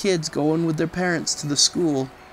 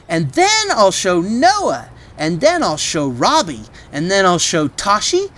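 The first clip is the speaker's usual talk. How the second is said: put-on eager, loud, high child rattling off names; excited child; 6 semitones higher, 5 dB louder than usual. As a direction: bounce with excitement, each name a treat. Trixie's eager excited list.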